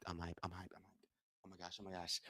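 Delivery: soft, breathless whisper, fading; hushed, fading whisper of excitement; whispered hype. Real, not acted. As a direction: murmur it to yourself. Repeated phrase fades into whisper.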